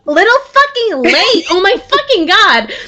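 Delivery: high, frantic halfling voice, half laughing; a panicked little halfling squealing mid-dodge. Acted. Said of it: "what you would assume to be the halfling".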